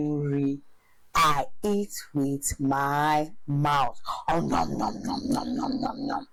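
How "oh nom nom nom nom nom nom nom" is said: mock eating sounds.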